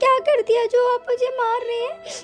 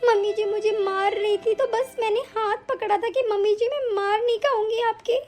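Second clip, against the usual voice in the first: sobbing between phrases; tearful pleading. Acted. Wife defending herself to her accusing husband, crying. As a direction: explain yourself through hurt sobs. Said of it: "अरे ये ज्यादा नाटक तो तुम अभी करोगी".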